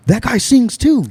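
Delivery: high, excited tattle; excited tattling. Retold or imitated. Q running to tell Ian, high excited voice. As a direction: blurt it out like a kid telling on someone. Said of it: "He runs and tells Ian".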